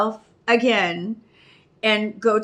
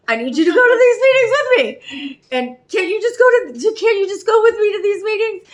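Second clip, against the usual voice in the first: high, loud, near-tearful begging; cling and beg, voice breaking; clingy pleading; 7 semitones higher, 4 dB louder than usual. Retold or imitated. Codependent self begging her sponsor, high tearful pleading. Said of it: "my codependency on her is".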